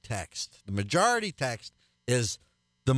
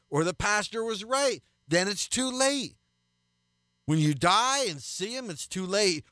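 rising, higher-pitched pulpit warning; fervent preaching; 8 semitones higher than usual. Acted. Radio pastor urging listeners to repent before it's too late, preaching high and insistent. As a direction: hammer home that it will be too late.

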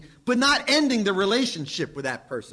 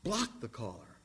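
quiet; 7 semitones lower, 10 dB quieter than usual. Acted. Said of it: "the third option".

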